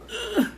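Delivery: high effortful ugh; straining grunt. Real, not acted. Lifting the heavy glass table, straining grunt. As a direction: strain against the weight.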